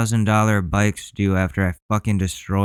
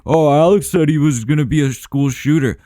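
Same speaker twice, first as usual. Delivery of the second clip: louder, higher sneering quote; mocking quote, louder and higher; mocking imitation; 5 semitones higher, 5 dB louder than usual. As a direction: mimic the people who blamed him. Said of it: "You think".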